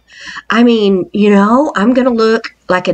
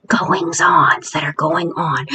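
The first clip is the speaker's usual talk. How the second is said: ordinary talk, nothing marked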